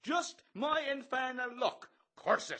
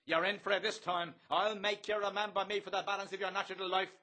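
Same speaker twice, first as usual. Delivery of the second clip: savage, menacing list of threats; 10 semitones higher, 3 dB louder than usual. A narrator's reading. Bello threatens Bloom with torture, savagely. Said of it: "Bello, savagely".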